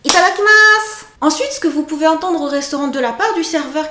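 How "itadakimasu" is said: itadakimasu bursting out higher and louder, full of joy; cheerful itadakimasu. Shown as a demonstration. Teacher showing the joyful way to say it, cheerful. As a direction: greet the meal with glee. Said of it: "enjouez itadakimasu".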